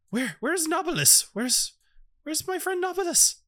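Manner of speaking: high-pitched, anxious character voice; worried searching character. Acted. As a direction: call for a missing friend in a high voice. Game master voices worried character seeking friend.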